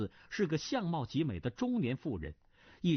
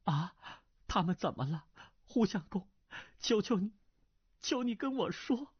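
higher and higher, begging; plead, rising to a high desperate beg; 8 semitones higher than usual. Acted. Nan Lan begging Hu Fei for news of her daughter, desperate plea. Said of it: "哀声求恳道".

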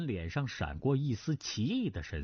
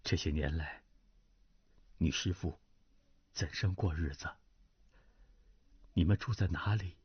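lower, subdued voice of a middle-aged lord; older nobleman, gentle; 8 semitones lower than usual. Acted. Duan Zhengchun voiced by narrator. (低沉温和的中年男子)

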